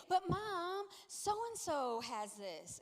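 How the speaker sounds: drawn-out mock kid's protest; pleading son. Son begging mom for a headset, whiny.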